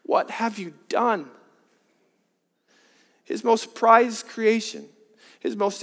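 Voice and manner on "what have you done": softer, slow and devastated; heartbroken. Shown as a demonstration. God grieving over his betrayed creation, soft and heartbroken. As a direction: let the question fall away in grief. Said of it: "Can't you hear the devastation in his voice?".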